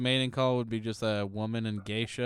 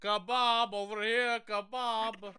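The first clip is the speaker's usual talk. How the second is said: higher calling voice, kebab over here; mock Greek mating call; 8 semitones higher, slower than usual. Mimicking a Greek mating call, higher calling voice. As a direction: hawk it like a street vendor calling. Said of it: "What is a Grecian mating call?".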